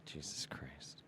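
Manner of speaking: breathless whispered curse. Real, not acted. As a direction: mutter it under your breath, voiceless. Whispered exclamation.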